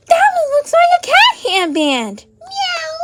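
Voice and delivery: high, gasping pretend delight; excited toy voice. Toy admiring a cat headband, high and excited. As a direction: squeak with wonder, then mew.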